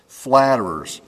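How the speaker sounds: ordinary delivery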